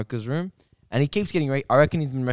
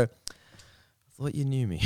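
softer, muttered aside; under the breath; 7 dB quieter than usual. Retold or imitated. Man muttering after being mistaken for someone, under his breath. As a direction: mutter it to yourself, acting it out. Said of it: "like, under your breath".

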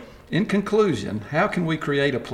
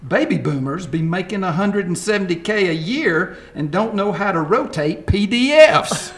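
lightly voiced millennial, a bit higher; sassy young millennial complaining; 6 semitones higher than usual. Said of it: "I'm gonna use my best millennial voice".

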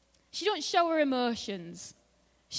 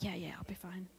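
whispered, breezy brush-off to dad; hushed whisper; 3 semitones lower, 12 dB quieter than usual. Retold or imitated. Teen whispering back to her worried dad, casually dismissive.

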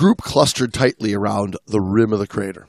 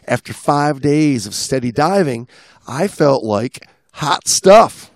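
read-aloud travel story, a bit louder; reading a dive log; 4 semitones higher, 4 dB louder than usual. Acted. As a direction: read the log with a touch of pride.

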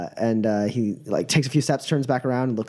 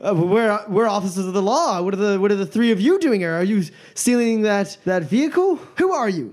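suspicious challenge; 6 semitones higher than usual. Acted. Guard questioning suspects.

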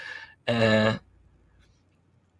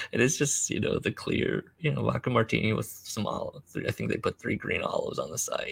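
ordinary delivery

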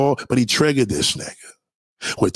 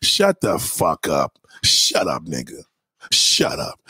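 dismissive shut up; 7 semitones lower, much slower than usual. Real, not acted. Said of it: "dismissive outburst".

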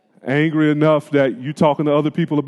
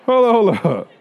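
raised, emphatic stop-right-there protest; protective "hold up". Retold or imitated. To a friend trashing his brother, a firm protective hold up. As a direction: cut off a friend who's badmouthing your brother. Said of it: "you're like".

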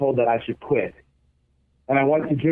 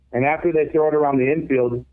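ordinary delivery

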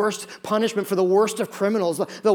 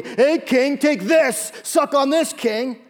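higher, louder sneering taunt; mocking sneer; 7 semitones higher, 3 dB louder, slower than usual. Soldiers taunt Jesus offering sour wine, higher mocking sneer. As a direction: jeer as you shove the sponge.

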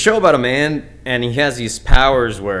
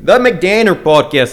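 put-on slow, loud, higher Danish accent; attempted Danish accent; 4 semitones higher, 5 dB louder, slower than usual. Host retries his show's name, in a shaky-attempt Danish accent. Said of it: "Let me try it again".

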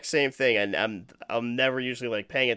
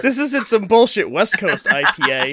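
louder, insistent snob voice; snobby beer nerd; 5 semitones higher, 5 dB louder than usual. Retold or imitated. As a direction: correct them like a beer snob. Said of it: "I'm like".

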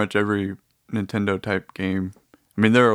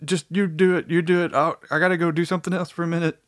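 high-pitched worn-out plea; tired pleading; 8 semitones higher, faster than usual. Retold or imitated. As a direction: beg off the game, pitched up and fast. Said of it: "I'm like".

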